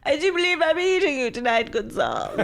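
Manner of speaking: hammed-up British accent, chef gloating; cartoonish British chef voice, lightly done; mock British chef. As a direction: play a cartoonish British chef savouring his dinner. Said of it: "I can't do a British accent. You can do one".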